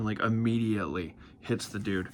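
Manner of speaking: usual speaking voice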